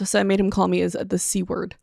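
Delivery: usual speaking voice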